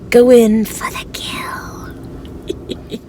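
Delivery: breathy creepy whisper; spooky whisper. Pretend. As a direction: whisper it like a lurking killer. Joking about a murderous snowman.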